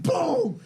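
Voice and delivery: high, sharp imitated boom; mock crash boom. Retelling the head-on crash to friends, a mock boom. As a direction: sound out the impact.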